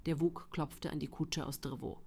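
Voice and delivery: plain reading voice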